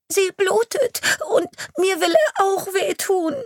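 put-on high, loud tearful child's voice; crying child. A frightened girl crying to the police chief about the devil. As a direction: cry it out in fear.

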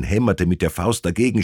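ordinary delivery

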